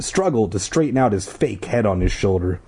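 ordinary delivery, nothing marked

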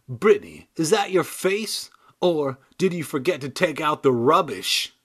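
cheeky British puppet insult; British dummy voice. Acted. Dummy insulting Brittany in a British accent. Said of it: "clearly from the U K".

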